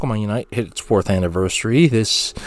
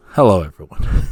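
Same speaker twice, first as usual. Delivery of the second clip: creepy hello; 4 semitones lower than usual. Acted. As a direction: greet them like a stalker in an empty room. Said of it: "feel a little threatened".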